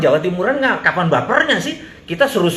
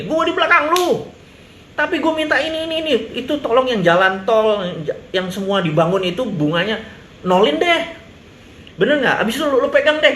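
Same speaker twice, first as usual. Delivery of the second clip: Chinese delegate impression; 5 semitones higher than usual. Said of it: "ngomongnya gini".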